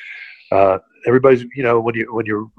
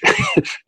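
real laugh. Real, not acted. Guest retelling a funny story, bursting into laughter.

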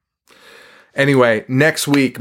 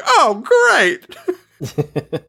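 pitched way up, mock-cheerful "Oh, great."; sarcastic high "oh great"; 12 semitones higher than usual. Grandma told she must move in, mock-cheerful high voice. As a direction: fake delight, clearly miserable. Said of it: "She's like".

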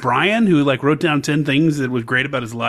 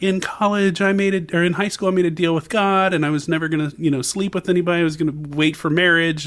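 pious beauty queen; 7 semitones higher than usual. Retold or imitated. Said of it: "she was like".

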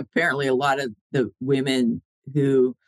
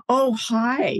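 high, chirpy hello; bright greeting; 9 semitones higher than usual. Retold or imitated. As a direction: say hi overly cheerfully. Greeting shunned people in court.

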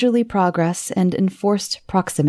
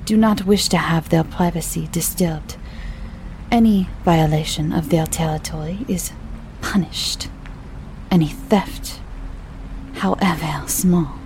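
ominous French innkeeper. Acted. Proprietor ominous warning.

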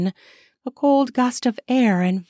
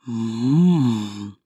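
long, low "mm"; deep hum swelling then falling; appreciative moan; 10 semitones lower than usual. Acted. Neighbor watching the couple, low appreciative moan. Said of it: "said Ben".

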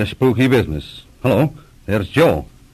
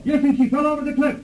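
distressed report; faster than usual. Acted. Reports girl's fall.

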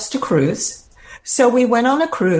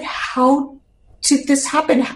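higher, breaking question of disbelief; stunned despair; 5 semitones higher than usual. Retold or imitated. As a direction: ask it as if the floor just dropped away. Collapsing at the mirror in shock.

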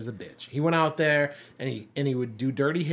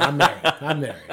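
ordinary talk

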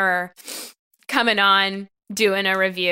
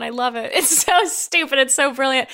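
ordinary talk, nothing marked

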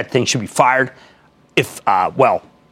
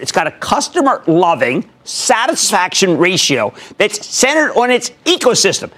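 normal talking voice

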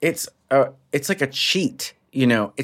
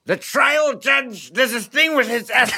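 very high, slow, drawn-out impression; Sam Waterston; 13 semitones higher, slower than usual. Comic demonstrating a TV-lawyer impression for host, high drawn-out voice. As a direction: stretch it out high, leaning on the S. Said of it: "what was Sam Waterston like".

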